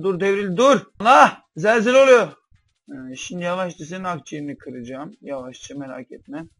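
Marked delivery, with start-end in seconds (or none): 0.0-2.3 loud, high cry of alarm; alarmed shout. Real, not acted. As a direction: yell as the table shakes. Masa sallanınca bağırıyor. (masa sallanınca yüksek sesle telaşlı bağırış)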